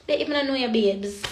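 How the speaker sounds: normal talking voice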